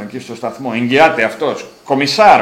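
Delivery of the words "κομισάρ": loud proud Κομισάρ with a falling end; proud commissar. Retold or imitated. Giant presenting himself as commissar, loud and proud. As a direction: announce your title with pride. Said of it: "μου λέει".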